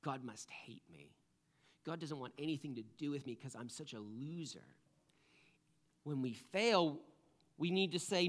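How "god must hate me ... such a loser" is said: hushed, low, defeated self-reproach; dejected self-talk. Retold or imitated. Preacher voicing old thoughts after failing, dejected. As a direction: mutter it softly, as if beaten down.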